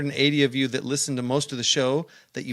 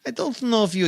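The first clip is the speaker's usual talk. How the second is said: Gru; 10 semitones higher, 3 dB louder than usual. Acted. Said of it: "sound like Gru".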